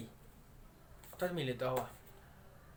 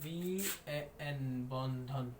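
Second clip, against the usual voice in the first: very slow, stretched spelling-out; drawn-out syllable demonstration; 4 semitones lower, 8 dB quieter, much slower than usual. Shown as a demonstration. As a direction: draw out each letter and syllable, softly. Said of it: "না এটা এরকম হবে".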